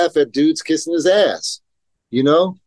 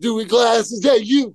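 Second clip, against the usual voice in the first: mocking taunt; 8 semitones higher than usual. Mocking the pro skater.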